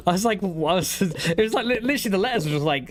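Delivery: high, giggling words through laughter; laughing. Real, not acted. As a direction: laugh as you tell it. Laughing while retelling PC crash.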